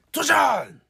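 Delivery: high, stretched show call after a laugh; catchphrase shout. Retold or imitated. Host repeating the show hint, imitated catchphrase shout. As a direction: call out the show's cry, high.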